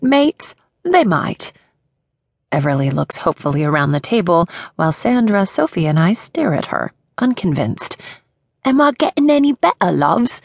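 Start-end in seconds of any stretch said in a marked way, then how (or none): 0.0-1.5 higher, hammed-up fake British "They might"; bad British accent. Everly doubles down to her friends in a higher bad British accent. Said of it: "the worst attempt at a British accent". 8.6-10.4 high, eager fake British accent; bad British accent. Everly asks her friends for approval in a bad British accent. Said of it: "Everly drops the accent".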